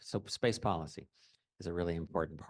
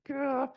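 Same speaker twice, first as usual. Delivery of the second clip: sharp, much higher, louder yelp of nerves; nervous gah; 6 dB louder than usual. Retold or imitated. Telling a panel about nerves before a flight, a sudden high nervous gah. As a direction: blurt a jittery little gah. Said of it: "I'm like".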